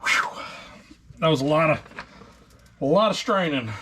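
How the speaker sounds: catching breath after effort; breathless, puffing between words. Real, not acted. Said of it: "I'm out of breath right now".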